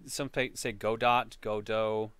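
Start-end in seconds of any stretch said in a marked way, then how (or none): 0.7-1.4 "Godot" said as GO-dot, T sounded; "Godot" rhyming its end with "dot"; variant pronunciation. Shown as a demonstration. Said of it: "different ways of saying it". 1.4-2.1 "Godot" said as GO-doh, silent T; long O in both syllables of "Godot"; variant pronunciation. Shown as a demonstration. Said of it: "different ways of saying it".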